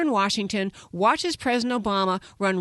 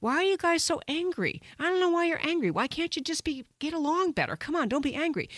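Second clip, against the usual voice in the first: high, whiny mock plea in a softer voice; mocking the left; 6 semitones higher, 3 dB quieter than usual. Radio host mocking the left's plea not to be angry, high and whiny. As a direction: parody the media scolding conservatives for being angry. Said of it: "the left and their pals in the media say".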